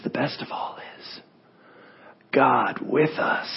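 whispered, then slow and faint; dying whisper. Acted. Dying preacher whispers final sermon to his friends, slow whisper. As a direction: breathe it out slowly, nearly gone. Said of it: "he starts whispering".

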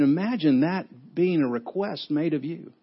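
usual speaking voice

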